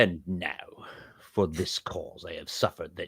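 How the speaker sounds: slow Sith lord scripture reading; Darth Sidious. Acted. Host reading the verse as co-host asked, Sith lord voice. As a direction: intone the verse like an evil emperor. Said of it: "that should be Darth Sidious".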